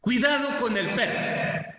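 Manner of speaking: "Cuidado con el perro" as a lifted, warning exclamation; exclamation intonation. Shown as a demonstration. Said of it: "¿Se dan cuenta la entonación que le damos?".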